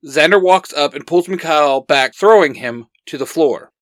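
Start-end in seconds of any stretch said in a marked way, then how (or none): none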